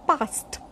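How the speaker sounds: passed said as past, light final t; t ending. Shown as a demonstration. Teacher giving an example, past with a light t ending. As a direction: end past on an unstressed t. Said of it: "we pronounce ta".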